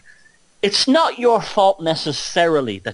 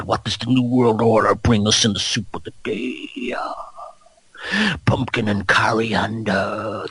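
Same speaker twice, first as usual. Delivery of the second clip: Alex Jones; 2 semitones lower than usual. Pretend. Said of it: "I do the Alex Jones voice all the time".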